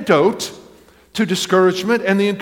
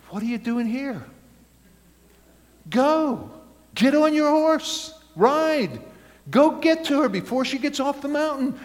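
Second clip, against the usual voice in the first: higher, urging voice of the shop lady; wise old shopkeeper; 7 semitones higher than usual. Acted. The wise lady in the store urges the moping hero to ride after her, in a higher, urging voice. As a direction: push him out the door, kindly but firmly. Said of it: "she looks at him and she says".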